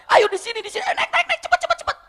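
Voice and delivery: very high, loud hurried calls; urgent shouting. Retold or imitated. Abu Hudzaifah calls fake allies to scare attackers, frantic shout. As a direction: rush imaginary comrades up the hill.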